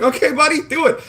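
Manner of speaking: patronizing. Retold or imitated. Streamer retelling his patronizing encouragement to a wannabe, high mock cheer. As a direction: humor him like a kid.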